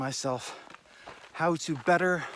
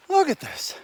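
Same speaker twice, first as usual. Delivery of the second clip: excited; 5 dB louder than usual. Real, not acted. Shows off the view.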